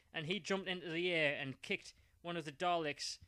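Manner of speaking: ordinary delivery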